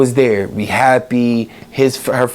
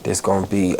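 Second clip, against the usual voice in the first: choked up; 4 semitones lower, 5 dB quieter than usual. Real, not acted.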